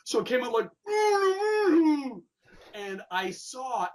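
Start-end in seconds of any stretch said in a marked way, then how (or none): 0.7-2.2 high, loud strangled groan through a shut jaw; Frankenstein groan. Retold or imitated. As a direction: groan it out like a wired-shut monster. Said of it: "this weird Frankenstein voice".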